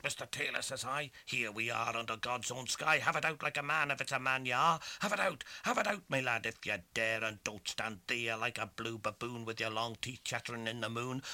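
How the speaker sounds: loud fierce challenge; challenging. Acted. Tom daring the lawyer to fight, loud and fierce. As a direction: dare him to fight, loud.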